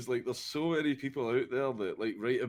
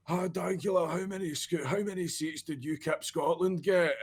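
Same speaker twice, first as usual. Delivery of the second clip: higher, calling-over heckle; light mock shout from a heckler; 8 semitones higher than usual. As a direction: shout it across the street at him. Retold heckler shouting from afar.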